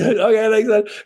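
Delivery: chirpy high mock sign-off; imitating unfazed agent. Agent ending a hostile call, unfazed. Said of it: "doesn't even faze him".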